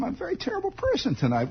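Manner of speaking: high, fast mock whine about himself; mock self-reproach. Real, not acted. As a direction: squeak out a fake confession, quickly.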